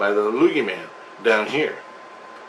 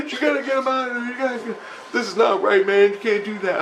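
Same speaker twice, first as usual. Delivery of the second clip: high, fast, pleading other voice; crew pleading; 9 semitones higher, faster than usual. Retold or imitated. The crew begging the speaker to bring him back, high pleading. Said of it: "they're going".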